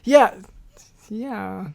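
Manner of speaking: ordinary talk, nothing marked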